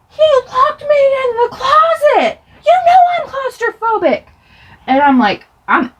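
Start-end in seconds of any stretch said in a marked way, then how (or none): 0.1-4.2 high, loud acted screech; outraged yelling. Child defending the kick to mom, outraged yelling. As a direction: scream your defense in outrage.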